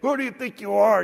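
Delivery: high, loud outburst, imitated; mock angry yell. Angry driver confronts pastor who cut him off, mock yelling. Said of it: "started yelling and cussing at me".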